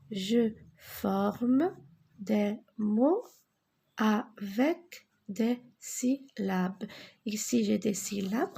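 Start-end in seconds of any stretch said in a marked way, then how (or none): 0.1-6.9 slow instruction. Shown as a demonstration. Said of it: "teacher repeats slowly".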